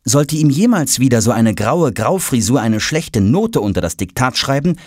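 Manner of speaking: ordinary delivery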